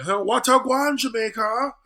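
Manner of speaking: high, loud Irish-pirate take on Jamaican; mock an American comic butchering the Jamaican accent; watered-down fake patois, hollered to the crowd; bad Jamaican accent. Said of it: "Irish pirate version of their accent".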